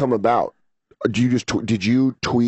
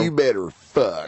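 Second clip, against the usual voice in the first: put-on Texas accent; slower than usual. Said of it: "That's a lot of pressure in a Texas accent".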